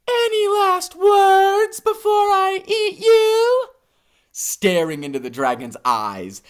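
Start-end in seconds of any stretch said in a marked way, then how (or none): 0.1-3.7 high, slow dragon menace. A narrator's reading. Dragon to hippo, mock-menacing high voice. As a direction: savor the threat before the meal. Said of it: "the dragon looks at the hippopotamus and says".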